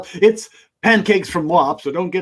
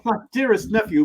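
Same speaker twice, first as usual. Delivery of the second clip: ordinary delivery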